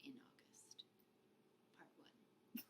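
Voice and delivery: trailing whispered title; whispering. Real, not acted. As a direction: whisper the ending.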